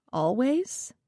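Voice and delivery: soft, tentative question; hesitant. A narrator's reading. Social worker friend hesitantly questioning the mother, softly. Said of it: "asked the question hesitantly".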